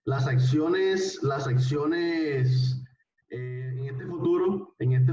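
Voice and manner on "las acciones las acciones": drawn-out, deliberate repeat; slow emphatic teacher voice; very slow emphasis. Shown as a demonstration. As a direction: stretch it out so they note it. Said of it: "teacher stressing a key phrase".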